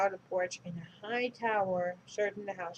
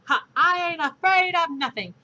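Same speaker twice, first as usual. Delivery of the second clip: boastful bluster; 9 semitones higher, 11 dB louder than usual. Acted.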